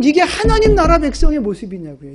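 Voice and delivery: usual speaking voice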